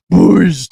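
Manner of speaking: loud, drawn-out boom noise; imitated explosion. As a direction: burst out a big mind-blown whoosh. Imitates a mind-blown explosion noise.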